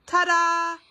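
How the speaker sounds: high, loud triumphant ta-da; ta-da reveal. A narrator's reading. Narrator revealing the baby, loud and high. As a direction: present it grandly.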